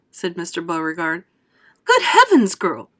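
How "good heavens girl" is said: high, loud screech. A narrator's reading. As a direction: screech in alarm. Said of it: "screeched Missus Beauregard".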